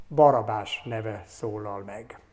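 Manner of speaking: ordinary talk, nothing marked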